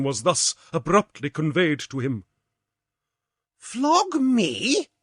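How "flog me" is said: high, disbelieving cry; outraged at the sentence; indignant cry. A narrator's reading. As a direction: Otto crying out in disbelief. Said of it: "cried he".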